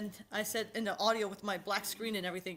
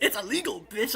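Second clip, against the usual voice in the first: louder British-ish dub line; British anime dub voice; 7 dB louder than usual. Pretend. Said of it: "it sounds like a British anime".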